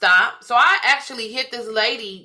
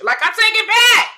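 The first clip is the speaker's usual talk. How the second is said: much higher and louder, indignant; sassy take-back; 12 semitones higher, 5 dB louder than usual. Retold or imitated. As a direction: squeal it out, outraged. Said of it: "I said".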